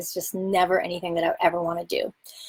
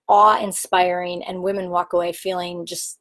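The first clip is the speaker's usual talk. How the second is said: ordinary talk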